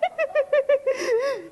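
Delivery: squeaky high sob-wail imitating Ken; high-pitched crying wail. Trainer imitating her client Ken crying in a high-pitched voice. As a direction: mimic a big man's high wailing cry. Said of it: "he had a really high-pitched voice".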